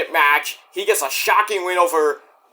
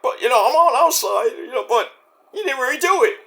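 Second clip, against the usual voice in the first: high mock reply; 8 semitones higher than usual. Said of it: "It's like".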